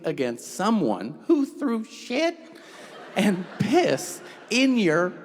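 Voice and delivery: slow, high disbelief; incredulous. Real, not acted. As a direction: lay out the absurdity slowly, amazed. Incredulous building outrage.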